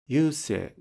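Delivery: normal talking voice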